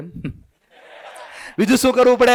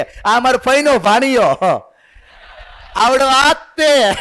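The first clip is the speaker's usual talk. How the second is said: higher, louder, slow proud boast; proud relative; 6 semitones higher, 3 dB louder than usual. Retold or imitated. Visitor boasting about relative's photo, slow and proud. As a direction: show off a cousin's son on the results board. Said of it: "ઈ કે".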